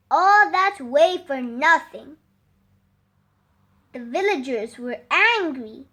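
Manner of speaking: usual speaking voice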